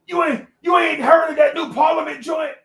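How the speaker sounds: high-pitched, shouted mock outrage; indignant father. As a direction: act out a dad appalled at such ignorance. Father indignantly defends funk.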